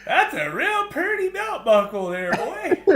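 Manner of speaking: hammed-up pastor. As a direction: tease the boy like a sly country pastor. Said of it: "the pastor says".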